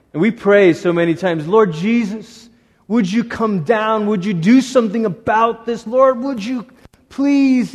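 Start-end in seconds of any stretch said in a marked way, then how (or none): 1.5-7.7 pleading prayer. Acted. Voiced prayer for help.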